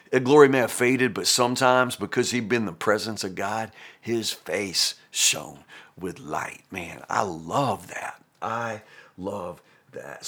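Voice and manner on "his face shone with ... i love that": tender awe. Real, not acted. Awed reflection on Moses' glory.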